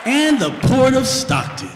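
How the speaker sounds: polished, proper emcee read; formal announcer voice. Acted. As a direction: welcome the crowd like a stiff host.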